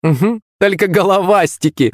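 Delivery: put-on high-pitched jeering girl; guffawing mockery. A narrator's reading. Chubby girl capping her friend's insult, gleefully mocking. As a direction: crow the jibe in a high voice. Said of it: "загоготала пухлая".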